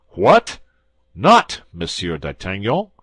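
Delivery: loud, startled double take; commissary stunned by the prisoner's claim; astonished exclamation. A narrator's reading. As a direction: bark out the disbelief. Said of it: "exclaimed the commissary".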